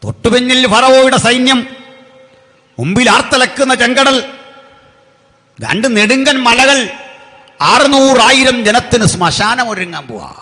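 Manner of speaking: high, loud dramatic proclamation; full-force preaching. Acted. Preacher paints army behind and sea ahead, full-force preaching. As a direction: hurl each phrase out with a pause after it.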